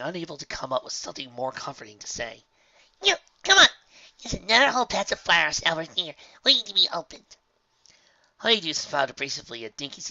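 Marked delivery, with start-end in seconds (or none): 3.0-7.4 high, loud and eager; excited filly. Acted. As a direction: cheer up your friend. Dinky cheering friend.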